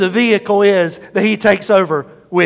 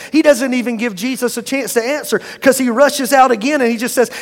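ordinary delivery

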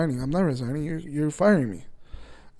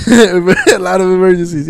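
laughing; 3 semitones higher, 11 dB louder than usual. Real, not acted. Laughs repeating friend's joke.

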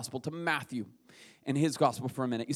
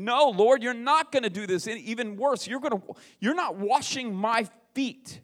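higher and louder, Peter refusing outright; indignant protest; 8 semitones higher, 6 dB louder than usual. Retold or imitated. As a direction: protest like a shocked disciple. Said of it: "the thing that Peter was saying".

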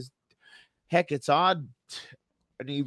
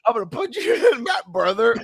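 higher, louder Hogan-style promo; wrestling promo voice; 7 semitones higher, 4 dB louder than usual. Pretend. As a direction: call him out like a wrestler. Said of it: "be like that".

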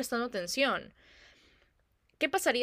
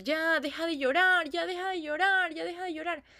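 पहली माँ की डाँट; 8 semitones higher than usual. Retold or imitated. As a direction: imitate a mother nagging a crying baby to stop. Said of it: "la mamá le dice en la primera".

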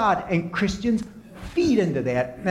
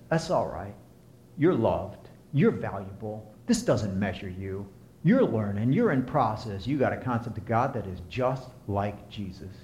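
gentle inner voice; 6 semitones lower than usual. Retold or imitated. Inner voice soothing a guilty believer, gentle and low. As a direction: comfort yourself kindly after failing. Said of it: "When that internal voice says".

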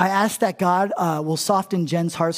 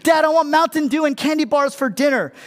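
put-on higher-pitched begging child; a kid nagging dad for bottle rockets, higher and pleading; whiny kid; 7 semitones higher, 4 dB louder than usual.

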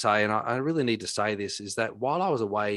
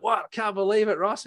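mock disbelief; 11 semitones higher, 4 dB louder than usual. Mock disbelief at lost job.

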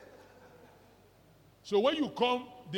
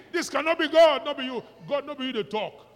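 much higher, much louder outraged refusal; mock outraged shout; 7 semitones higher, 13 dB louder than usual. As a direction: shout as if rebuking the devil. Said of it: "You know they talk like this".

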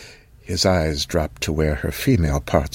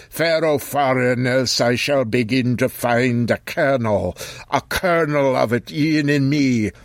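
put-on high, archaic old voice; an old man warning her away; old man Occam; 6 semitones higher than usual.

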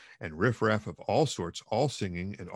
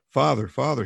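put-on a frightened child's cry, higher and louder; 4 semitones higher, 7 dB louder than usual. Boy crying to his father at the beating, frightened cry. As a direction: call out to father in alarm. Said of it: "he cried".

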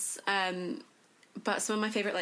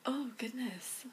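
slow, softer whisper; whispered exclamation; 5 semitones higher, 5 dB quieter than usual. Real, not acted. Guest still searching for an answer, slow soft whisper. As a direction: sigh it out slowly under the breath.

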